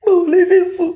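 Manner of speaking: anguished crying plea. Real, not acted. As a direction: break down crying as you ask. Mother cries out how they sleep.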